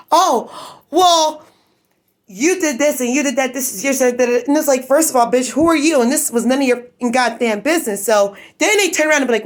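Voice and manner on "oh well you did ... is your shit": mocking imitation. Mocking the neighbors' accusations.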